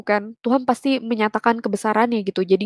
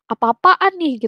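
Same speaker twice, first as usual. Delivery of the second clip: imitating the brother's irritated question, pitched higher; mock angry retort; retold angry outburst; 6 semitones higher, slower than usual. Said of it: "dia sempet marah". (menirukan adik yang marah)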